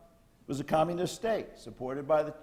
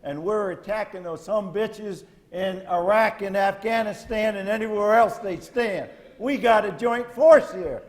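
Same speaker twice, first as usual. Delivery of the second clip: building louder and higher to a shouted cheer; mock rallying battle cry; 5 semitones higher, 7 dB louder than usual. Speaker rallies veterans as a joint force, loud mock battle cry. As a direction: fire up the troops in a mock briefing.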